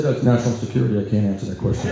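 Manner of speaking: low, deadpan stonewalling; deep-voiced, solemn no comment; rabbi's official refusal. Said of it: "He said".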